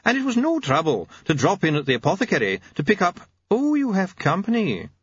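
high, chirpy visitor; cheery, mild-mannered doctor; bright smile. A narrator's reading. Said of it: "smiling brightly".

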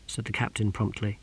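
plain reading voice

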